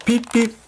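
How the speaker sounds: high piped beep beep; imitated whistle. A narrator's reading. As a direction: whistle like the engine leaving. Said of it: "Thomas whistled".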